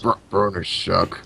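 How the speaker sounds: low, slow mock grumble; Companion. Joining the Companion parody, deep slow put-on voice. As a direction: join in with a deep, plodding impression. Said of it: "Companion should have been like".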